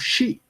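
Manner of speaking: "shi" with a hushing sh sound. Shown as a demonstration. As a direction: say "shi" starting on a soft sh. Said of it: "S H siempre pronunciamos".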